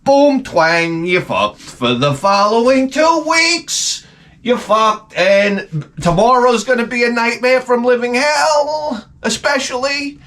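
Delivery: high strained mock voice, slow; the back itself gloating in a high pitched voice; voice of the back twang. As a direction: let the body's doom squeal out high and dragged. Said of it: "It was more like".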